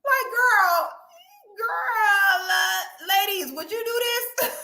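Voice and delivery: high, laughing plea to the ladies; shrill crying-laugh appeal; squealing plea. Real, not acted. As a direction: beg them through laughter. High pleading to viewers.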